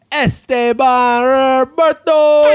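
high, slow, loud name call; announcer voice. Acted. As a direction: announce your own name big. Host names himself grandly.

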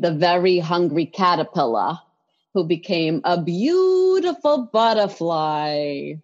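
slow, lightly Brooklyn storybook reading; Brooklyn accent. Retold or imitated. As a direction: read it like mom, a hint of Brooklyn. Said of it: "So she would read".

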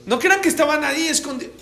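loud, high insistent burst; emphatic exclamation. Real, not acted. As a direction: cry it out in disbelief, high and loud. Stressing the camps were in plain sight.

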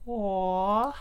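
high, drawn-out cooing aw; long rising sympathetic coo; imitated "aw". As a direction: imitate a touched listener's aw. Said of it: "she looks at me and goes".

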